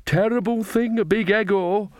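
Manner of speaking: high-pitched feeble excuse; weak. A narrator's reading. Nanny Ogg excusing Granny to Magrat, weakly. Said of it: "said Nanny Ogg weakly".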